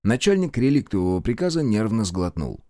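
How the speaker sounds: usual speaking voice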